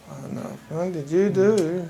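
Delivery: dismayed onlooker. Acted. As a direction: slowly voice a bystander's dismay. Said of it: "just kind of looking at you, going".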